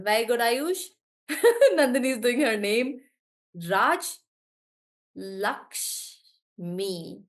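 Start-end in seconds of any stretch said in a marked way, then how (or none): none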